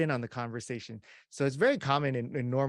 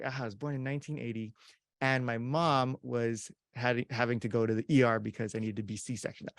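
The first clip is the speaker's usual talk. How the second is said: rushed continuation. Shown as a demonstration. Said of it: "But what if I was like".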